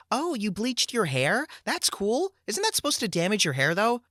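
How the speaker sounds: put-on breezy, curious young woman; girly voice. Girl at the party asking about bleached hair, high and airy.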